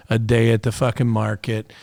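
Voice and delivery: normal talking voice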